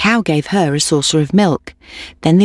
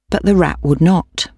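ordinary delivery

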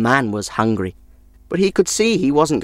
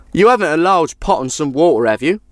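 the traveller; 6 semitones higher than usual. Acted. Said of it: "asked the man".

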